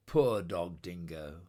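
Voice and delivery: plain reading voice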